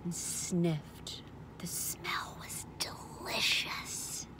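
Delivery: slow, soft whispery narration; hushed, drawn-out delight; savouring hush. A narrator's reading. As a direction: breathe it out slowly, relishing the smell. Savouring the smell of slops.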